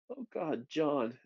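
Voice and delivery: high, hushed acted distress; dawning dismay. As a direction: imitate horrified realization about a friend. Acts Ben realizing the sacrifice.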